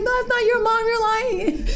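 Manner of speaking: high, teasing schoolkid voice with a laugh; mocking kids. Classmates telling the daughter she is lying, in high teasing kid voices. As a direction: taunt like a fourth-grade classmate.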